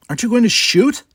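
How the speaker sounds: high, loud insistent demand. A narrator's reading. Jana demanding the captain fire, loud insistent. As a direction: press the captain hard. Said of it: "Jana demanded".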